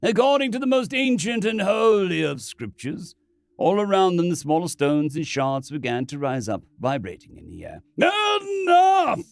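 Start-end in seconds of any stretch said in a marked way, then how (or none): 0.0-3.1 solemn priest. Acted. Priest intones the rite. 8.0-9.2 loud high furious shout. Acted. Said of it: "Ronson shouted".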